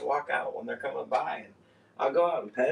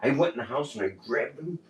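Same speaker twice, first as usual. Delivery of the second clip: normal talking voice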